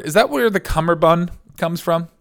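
normal talking voice

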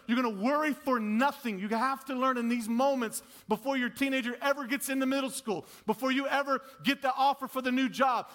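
loud, high preaching push; full-force preaching. Acted. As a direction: drive it home to the congregation.

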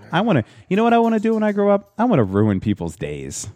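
high, eager mock wannabe, falling at the end; mock wannabe cop. Mocking cops' career dreams, hammed-up eager voice. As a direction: play an over-keen kid with a mean ambition. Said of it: "they're just like".

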